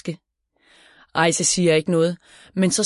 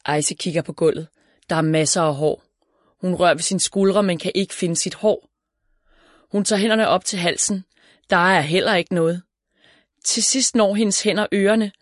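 ordinary delivery, nothing marked

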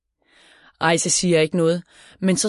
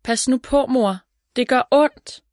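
put-on higher-pitched protest from a hurting child; calling out in pain; 7 semitones higher than usual. A narrator's reading. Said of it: "råber Ejse".

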